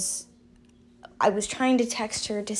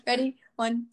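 ordinary delivery

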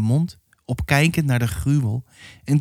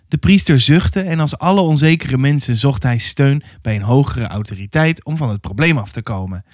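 ordinary delivery, nothing marked

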